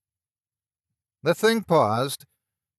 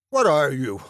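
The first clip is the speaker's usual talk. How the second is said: fierce demand; 3 semitones higher, 5 dB louder than usual. A narrator's reading. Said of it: "as fiercely as I could".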